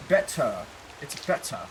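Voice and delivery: "better" as "bet-tuh", T fully sounded; British crisp T. Shown as a demonstration. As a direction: enunciate the T in "better", drop the R. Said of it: "you always say your T's".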